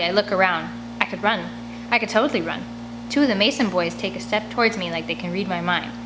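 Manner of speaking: plain reading voice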